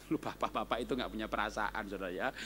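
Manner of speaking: low, soft jab at the men; soft teasing aside. Real, not acted. Teasing aside about fathers.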